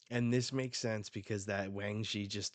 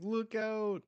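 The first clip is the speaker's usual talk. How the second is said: alarmed; 13 semitones higher, 4 dB louder than usual. Acted. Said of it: "the Marines are like".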